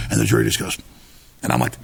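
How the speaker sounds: ordinary talk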